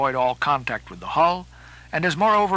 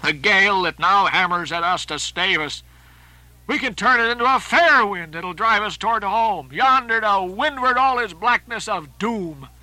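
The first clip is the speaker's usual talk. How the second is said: high, loud dramatic soliloquy; raised, foreboding voice of a ship's mate; impassioned Starbuck; 5 semitones higher, 4 dB louder than usual. Acted. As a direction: declaim it with rising urgency.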